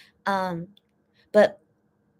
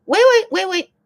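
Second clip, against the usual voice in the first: urgent wait; 11 semitones higher, 9 dB louder than usual. Real, not acted. Suddenly stops while reading.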